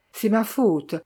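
usual speaking voice

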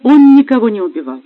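wife defending her lover, despairing and loud; desperate outburst. Acted. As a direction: insist in desperation he is innocent. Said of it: "в отчаянии заговорила Ольга".

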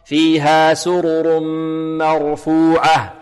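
سرر مرفوعة slow with a full fatha on the م; correct reading. Shown as a demonstration. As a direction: give the م of مرفوعة a full open vowel, slowly. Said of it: "خطأ".

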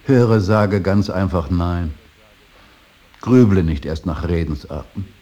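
loud, hard rebuke to the son; angry scolding. Acted. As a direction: snap at him to just say no. Father scolds his son. (laut scheltend)

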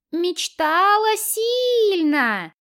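high, slow mock squeak; squeaky voice. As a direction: stretch it out in a thin squeaky voice. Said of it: "изображая писклявый голос".

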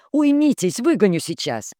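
sharp high scolding shout; scolding mother. Acted. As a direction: threaten the girls sternly.

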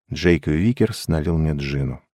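ordinary delivery, nothing marked

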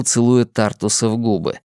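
ordinary delivery, nothing marked

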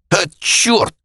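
high, pained curse; cry of pain. Acted. Bodyguard kicked in the ribs, yelping in pain. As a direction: curse as the kick lands.

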